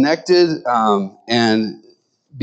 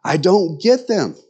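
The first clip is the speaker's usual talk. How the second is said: dog's view; 5 semitones higher than usual. Retold or imitated. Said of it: "a dog looks at a cat and says".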